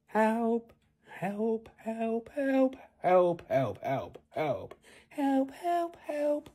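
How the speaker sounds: high, thin cries for help; child crying for help. Acted. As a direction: call out like a trapped little boy. Trapped son calls for help.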